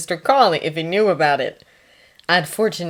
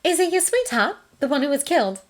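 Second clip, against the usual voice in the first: higher-pitched, casual enquiry; casual question; 10 semitones higher than usual. A narrator's reading. Nurse asks about his dead sweetheart, casually. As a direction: ask it as if in passing. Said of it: "she asked casually".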